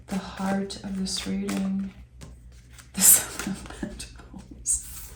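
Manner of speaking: hushed whisper. Real, not acted. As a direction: whisper it as if reading a card to yourself. Reading the drawn card to herself.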